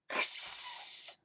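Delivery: breathy long hiss; goose hiss. Acted. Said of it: "said the goose".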